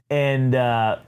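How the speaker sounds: usual speaking voice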